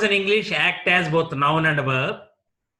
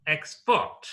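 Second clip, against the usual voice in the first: Export as ex-PORT; second-syllable stress. Shown as a demonstration. Teacher repeating the verb, Export stressed on second syllable. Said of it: "It's verb".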